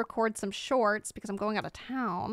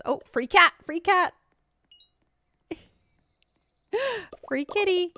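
excited; 8 semitones higher, 4 dB louder than usual. Real, not acted. Spots a free cat in game.

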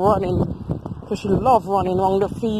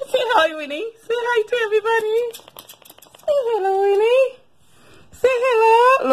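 much higher sing-song coaxing; 12 semitones higher than usual. Real, not acted. Coaxes the dog to greet viewers.

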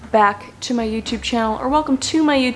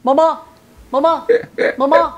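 louder, pestering cartoon child; Family Guy impression; 2 semitones higher, 4 dB louder than usual. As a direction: call repeatedly like a cartoon kid. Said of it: "I told you I was Family Guy".

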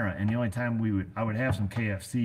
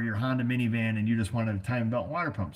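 ordinary delivery

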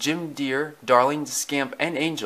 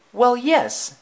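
high, louder Lady voice; Lady admitting; 12 semitones higher, 5 dB louder than usual. Acted. Lady admits to Tramp he has a point, high-voiced concession. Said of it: "Lady admitted".

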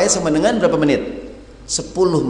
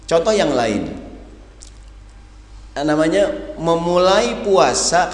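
ordinary talk

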